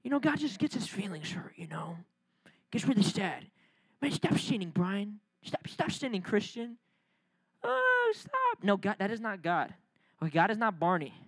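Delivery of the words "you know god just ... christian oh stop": softer, mocking a weepy God; mock soft sad God. Preacher mocking coworker's idea of a sad God, soft. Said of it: "he's like".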